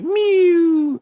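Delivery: very high loud kitten meow; kitten scream. Retold or imitated. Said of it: "he's got the classic".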